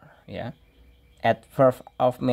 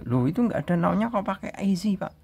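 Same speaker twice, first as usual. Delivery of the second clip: mock student complaint; 7 semitones higher, faster than usual. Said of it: "biasanya anak komplain nanti".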